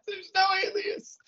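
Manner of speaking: squeaky high words through laughter; real laugh-crying over a dumb joke. Real, not acted. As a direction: get it out while still cracking up.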